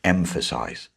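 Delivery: ordinary talk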